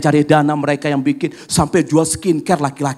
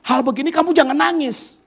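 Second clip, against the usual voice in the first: high, firm big-sister voice, a touch fast at first; older sister's firm advice; 12 semitones higher than usual. Retold or imitated. Older sister scolds crying sister, firm and high. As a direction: urge her little sister to face it, not cry. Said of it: "kakaknya bilang apa".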